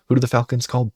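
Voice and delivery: a showman's second ask; hyped repeat. Acted. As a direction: raise it to build suspense. Rhetorical question, repeated with more drive.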